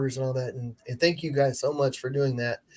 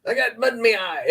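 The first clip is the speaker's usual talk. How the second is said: silly peasant voice; 7 semitones higher than usual. Retold or imitated. Mock medieval peasant voice.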